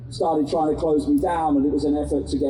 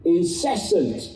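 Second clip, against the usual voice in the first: urgent activist; 4 semitones higher than usual. Acted. Impassioned speech to crowd.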